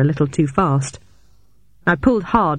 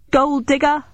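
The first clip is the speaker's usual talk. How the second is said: yelling; 10 semitones higher than usual. A narrator's reading. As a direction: yell it across as a taunt. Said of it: "I yelled".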